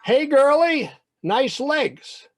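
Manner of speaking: high, loud bully's taunt; jeering yell. Acted. Benny mocking the boy in the schoolyard, jeering yell. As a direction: holler it mockingly across the yard.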